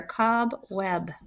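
"Cob web" softly in two clear beats; each syllable clear. Shown as a demonstration. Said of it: "You can hear each syllable very clear".

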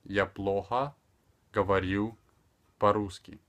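"Я плохо говорю по-русски" in separated chunks; careful teaching delivery with pauses. Shown as a demonstration. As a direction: say it piece by piece. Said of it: "speaking extremely slower".

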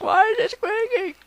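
very high, squeaky mock viewer voice; imitating viewers in a high squeaky voice. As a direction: squeak it like a baffled fan. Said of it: "you guys be like".

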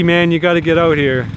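ordinary talk

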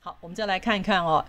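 normal talking voice

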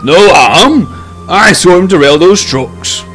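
high, loud protest; incredulous outburst; indignant exclamation. A narrator's reading. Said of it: "Bear exclaimed".

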